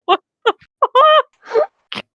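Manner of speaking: high, slow, incredulous what the fuck; can't believe what was just read; stunned disbelief. Real, not acted. Reader stunned by the fanfic line, high disbelief.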